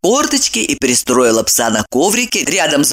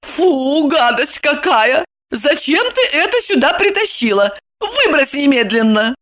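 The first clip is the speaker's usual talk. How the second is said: disgusted; 9 semitones higher than usual. Acted. Disgusted at the dog.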